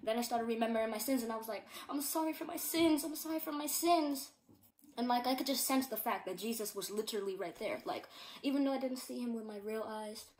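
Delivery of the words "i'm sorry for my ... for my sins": high, loud pleading apology; a retold heartfelt plea for forgiveness. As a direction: beg forgiveness with a raised voice. Retold tearful repentance prayer.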